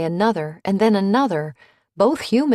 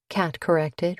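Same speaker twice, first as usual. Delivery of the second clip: ordinary delivery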